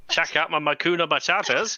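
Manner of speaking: high, loud comic show-off; hammed-up bragging to distract the enemy; brazen taunt. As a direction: show off loudly, playing it for laughs. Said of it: "lifted your top up and go".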